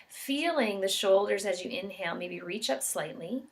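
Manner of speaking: calm, measured meditation guide with long pauses; guided meditation. Real, not acted. Yoga instructor guiding listeners into an expansiveness meditation, calm and unhurried. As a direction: guide them gently through each breath, leaving space between phrases.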